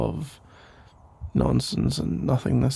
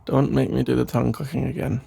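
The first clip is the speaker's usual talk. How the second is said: normal talking voice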